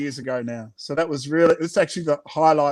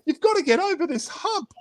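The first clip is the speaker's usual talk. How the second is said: much higher encouraging dish voice; 12 semitones higher, faster than usual. Acted. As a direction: cheer her on in a high voice. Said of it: "tried to support her".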